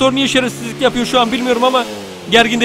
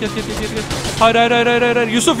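usual speaking voice

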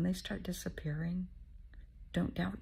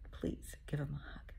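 whispered plea; 7 dB quieter than usual. Real, not acted. Repeats plea, softer.

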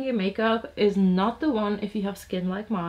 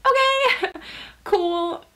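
much higher and louder okay, breaking into a laugh; shocked squeak of an okay, laughing; startled mock okay with a laugh; stunned okay; 11 semitones higher, 6 dB louder, much slower than usual. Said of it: "we're like".

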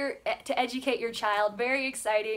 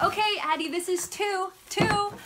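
put-on teaching a toddler; 6 semitones higher than usual. Shown as a demonstration. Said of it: "then you'd be like".

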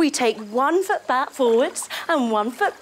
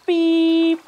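loud, higher mock beep; truck beep; 6 semitones higher, 6 dB louder than usual. Leader making a truck horn beep for kids. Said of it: "start our logging truck with a beep".

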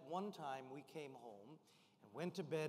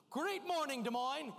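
much higher, loud rousing greeting; loud cheer; 8 semitones higher, 7 dB louder than usual. Shown as a demonstration. Speaker greeting Des Moines, loud high cheer. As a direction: throw it to the whole room. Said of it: "I'm gonna say, Great morning".

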